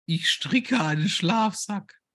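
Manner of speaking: high, slow sweet housewife; wife voice. Acted. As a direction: chirp it like a doting wife. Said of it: "du bist meine Frau".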